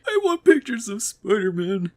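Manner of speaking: high, half-hearted demand; a timid first attempt at anger; weak try at yelling. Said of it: "one of the demons goes up to Jonah".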